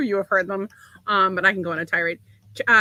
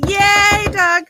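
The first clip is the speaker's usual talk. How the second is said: excited cheer; 10 semitones higher, 10 dB louder than usual. Real, not acted. Cheering Doug's music work.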